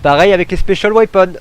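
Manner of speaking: high, loud eager burst; excited. Real, not acted. Player to viewers as the flying Daleks appear, loud and excited. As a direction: shout it out, thrilled.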